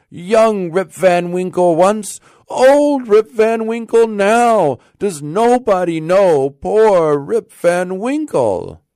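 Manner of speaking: high, loud, slow impassioned proclamation; crying out. A narrator's reading. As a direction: plead with the whole crowd to know you. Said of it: "cried he".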